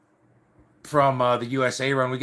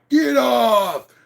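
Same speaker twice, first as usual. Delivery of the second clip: high, loud barked order from the cop; sharp shouted command to halt; cop's shout; 9 semitones higher, 5 dB louder than usual. Retold or imitated. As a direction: yell it like a cop stopping a runaway. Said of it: "he was like".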